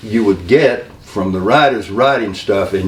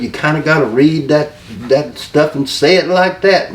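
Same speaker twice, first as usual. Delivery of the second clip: Dusty Rhodes impression; 5 semitones higher than usual. Dusty explaining to him the writers rule, in a high imitation. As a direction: talk him down like Dusty would.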